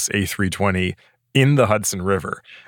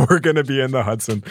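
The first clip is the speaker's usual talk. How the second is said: laughing while imitating the pilot; 4 semitones higher, faster than usual. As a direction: chuckle through a calm pilot line.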